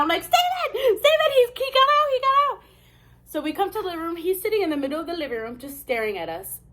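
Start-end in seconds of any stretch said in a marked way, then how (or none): 0.2-2.6 very high panicked squeal; retold freak-out. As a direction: re-enact frantically calling out for husband. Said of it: "And I'm freaking out".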